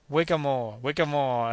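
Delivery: a mild Scottish-style carter's call; gleeful cry. Acted. Joe urging the elephant on with a mild gleeful call. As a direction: call it out in high glee. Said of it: "shouted Joe in a high glee".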